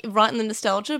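ordinary talk

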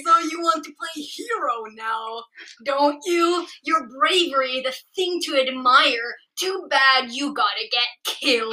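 feral snarling. Acted. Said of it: "you're honestly really good at this".

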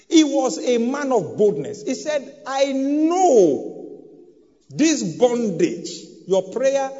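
loud, rising sermon cry, high and strong; preaching at full force. Acted. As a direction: preach it out with full fire to the congregation.

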